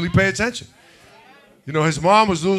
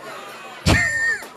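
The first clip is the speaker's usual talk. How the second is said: high-pitched laugh; 4 dB quieter than usual. Real, not acted. Laughs at audience member.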